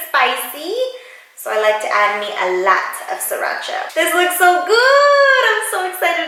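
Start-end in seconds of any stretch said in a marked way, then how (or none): 3.9-6.3 drool over the food; excited. Real, not acted. First look at the pho.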